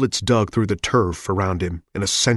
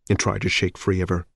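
plain reading voice

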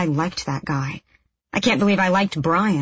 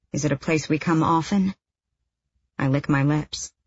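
ordinary delivery, nothing marked

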